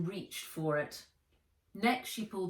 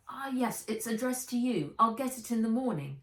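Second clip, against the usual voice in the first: put-on higher, quick reassuring girl; caught-out girl; 6 semitones higher, 3 dB louder than usual. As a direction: brush it off hurriedly.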